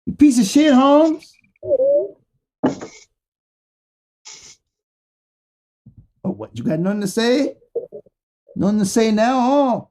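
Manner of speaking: high, loud wrestler trash talk; Rey Mysterio impression. Calling out Donald Trump as a hyped wrestler. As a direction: hype it up like a wrestler calling out a rival. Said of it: "Rey Mysterio".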